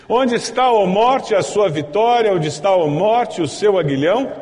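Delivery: loud, high-pitched ringing challenge to death; exultant scripture taunt at full voice; triumphant declamation. Acted. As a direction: proclaim the verse like a victory cry. Triumphant first reading of the verse.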